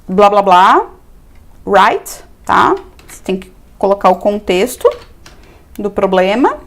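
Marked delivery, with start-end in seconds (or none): 1.7-2.2 "right" rising as a question; questioning tag. Shown as a demonstration. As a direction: let "right" swing upward at the end. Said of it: "Sempre termina com interrogação".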